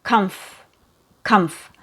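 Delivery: "comf" ending straight on the f; "comf" as a single soft syllable; no following vowel. Shown as a demonstration. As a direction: stop "comf" on the f with no vowel after. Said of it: "we don't have a vowel sound".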